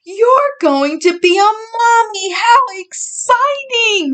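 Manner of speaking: high, thrilled exclamation; excited. A narrator's reading. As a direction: burst with joy for the news. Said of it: "Mama exclaimed".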